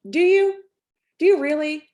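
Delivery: sceptical challenge. Retold or imitated. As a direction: throw it back at him in disbelief. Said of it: "I looked at him and I go".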